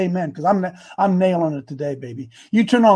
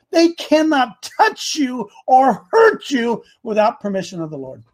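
ordinary talk, nothing marked

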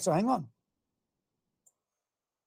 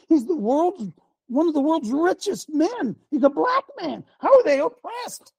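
rising indignation; 9 semitones higher than usual. Real, not acted. Preacher challenging the congregation about oppression, incredulous rising pitch. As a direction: pitch climbing much higher into an incredulous question.

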